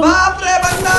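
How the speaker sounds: loud, high-pitched startled outburst; alarmed shout. Real, not acted. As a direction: shout in shock at the enemy. Enemy suddenly appears in game. (घबराकर ऊँची आवाज़ में चिल्लाते हुए)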